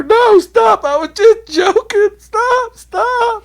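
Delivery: very high, loud, panicked falsetto begging; pleading squeal. Retold or imitated. Drunk begging the big guy to stop, squealing falsetto. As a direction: squeal like a scared kid begging to quit. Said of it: "That dude's just sitting back there".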